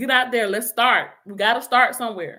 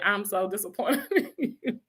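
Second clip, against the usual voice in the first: softer, laughing through a nephew's scolding; mock disappointed nephew; 5 dB quieter than usual. Aunt imitates her nephews scolding her, laughing. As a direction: play the let-down nephew while cracking up. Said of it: "they'd be like".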